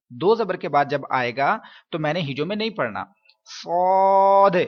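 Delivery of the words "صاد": صاد full and heavy, louder; teacher shows صاد as a heavy letter. Shown as a demonstration. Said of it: "پر پڑھوں گا".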